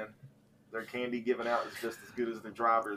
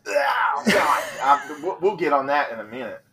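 loud, high, appalled outburst; disgusted shout; 4 semitones higher, 10 dB louder than usual. Real, not acted. Disgusted outburst at Almond Joy.

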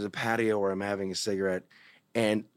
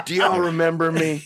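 laughing mock greeting; 7 semitones higher, 8 dB louder than usual. Joking return as job applicant.

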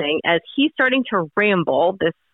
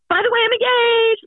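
high, sing-out mock cheery announcement; 13 semitones higher than usual. Bride imagining announcing engagement after mom's obituary, mock-excited. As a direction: chirp it high, imitating a gushing post.

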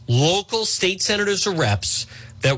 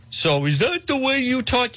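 mock listener; 9 semitones higher than usual. Mocking a listener's complaint.

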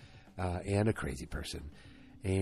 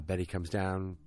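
ordinary talk, nothing marked

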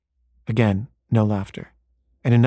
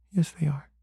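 put-on soft, higher little-girl reply; small child's voice; 4 semitones higher, 7 dB quieter than usual. Daughter contradicts her parent, small child's voice. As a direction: quietly insist like a small child.

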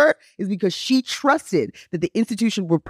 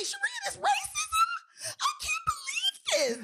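high, wailing mock sob of disbelief; whimpering high imitation of a shocked friend; mock crying disbelief; 11 dB quieter, slower than usual.

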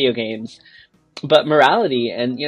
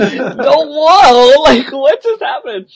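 high and louder, laughing in shock; high shocked disbelief; 11 semitones higher, 9 dB louder, much slower than usual. Retold or imitated. Gamer reliving the scene's shock, high and laughing. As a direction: relive the moment it hit you.

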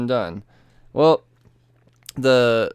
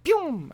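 laser pew. Retold or imitated. Laser beam fired.

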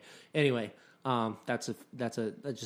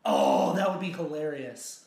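ordinary talk, nothing marked

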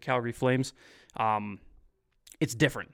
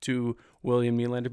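usual speaking voice

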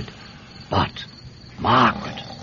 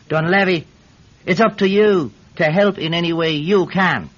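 lightly voiced summons, higher; voice of fate; 8 semitones higher than usual. The fates calling on Dunlevy to help, raised proclaiming voice. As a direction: proclaim it like a call to duty. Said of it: "the fates were pointing their fingers at me, saying".

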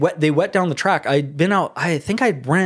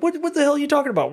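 much higher, fast incredulous cry; mock baffled reaction to a stranger's insult; baffled outburst; 12 semitones higher, much faster than usual. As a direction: blurt it out in total disbelief.

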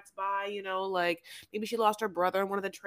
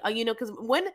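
normal talking voice

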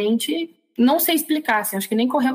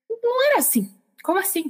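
high-pitched baffled reaction; recalled disbelief, pitched well up; incredulous objection; 10 semitones higher than usual. Retold or imitated. Believer recalls objecting to past-life talk, incredulous.

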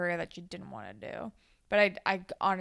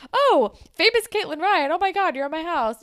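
starstruck gush; 7 semitones higher, 5 dB louder than usual. Retold or imitated. Margot starstruck by a famous guest.

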